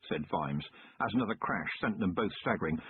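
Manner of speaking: usual speaking voice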